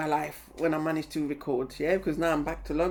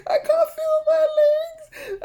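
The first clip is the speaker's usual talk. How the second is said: high, loud, shaky cry of fear; panicked wail; 8 dB louder, slower than usual. Retold or imitated. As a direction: wail like your legs are giving out on the bridge. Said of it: "I was like".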